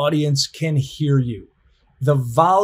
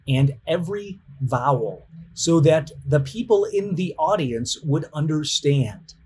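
ordinary talk

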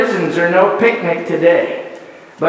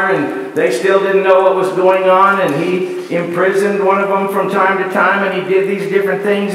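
ordinary delivery

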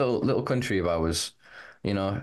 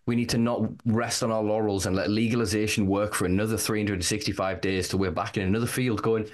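usual speaking voice

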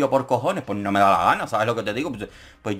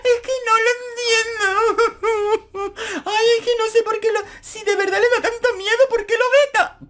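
mock crying Tania; 3 dB louder than usual. Vlogger imitating Tania crying on the show, high mock sobbing whine. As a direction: whimper it like an overdramatic crybaby. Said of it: "Y empieza".

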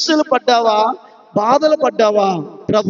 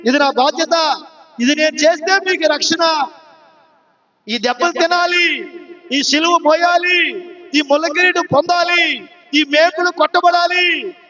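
fiery preaching; 4 semitones higher than usual. Acted. Jesus rebukes the weeping women.

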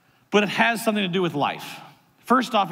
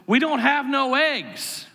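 put-on slow, higher-pitched little-kid voice; drawn-out kid voice, pitched up; four-year-old boy; 7 semitones higher, slower than usual. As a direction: imitate a small child announcing news. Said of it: "Zeke is my four-year-old".

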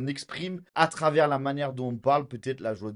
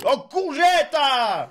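put-on shrill, much higher and louder old woman yelling at a driver; old lady insult; 10 dB louder, much slower than usual. Granny shouting insult at driver stuck at red light, high mock yell. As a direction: imitate a little granny shouting from the roadside. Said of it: "une petite mamie qui sort et qui lui fait".

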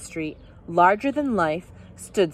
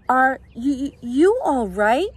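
higher, slow, hesitant question; shy question; 4 semitones higher, 4 dB louder, slower than usual. A narrator's reading. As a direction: ask it shyly, drawing out the words. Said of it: "she asked shyly".

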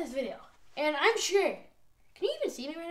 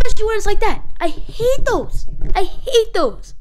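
frustrated groan; 6 semitones higher, 7 dB louder than usual. Real, not acted. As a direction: drag out the hate in frustration. Upset by the replayed loss.

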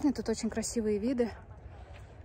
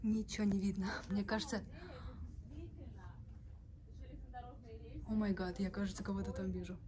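hushed; 16 dB quieter than usual. Real, not acted. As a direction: keep it hushed and wary. Hushed talk in dark tunnel.

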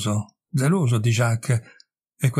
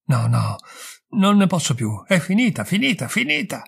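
violent outburst; 6 semitones higher than usual. A narrator's reading. Irene refusing her lover's advice, a rising violent outburst. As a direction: let it burst out, rising to a breaking peak on the last finita. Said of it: "proruppe violentemente".

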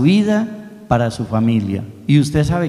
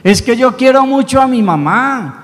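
put-on pleading little boy; 8 semitones higher, 3 dB louder than usual. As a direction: beg the statue in earnest, like a small boy. Boy insisting to the statue.

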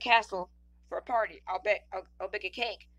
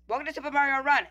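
a hint of Mario's accent; 5 semitones higher, 4 dB louder than usual. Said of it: "I hope I don't have to do the accent".

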